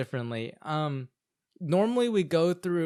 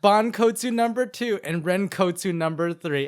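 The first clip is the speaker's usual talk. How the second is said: announcer; 4 semitones higher, 7 dB louder than usual. Acted. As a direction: announce the winners proudly. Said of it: "announcing ranking".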